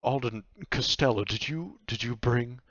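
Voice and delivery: touched nightmare, pitch lifted; hesitant nightmare. Acted. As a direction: ask haltingly, hardly daring to believe it. Nightmare questions Alden.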